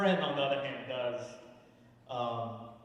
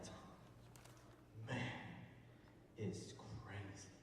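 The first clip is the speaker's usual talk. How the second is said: hushed awe; 8 semitones lower, 18 dB quieter than usual. Retold or imitated. Friend back from Vietnam telling Tom, hushed awe.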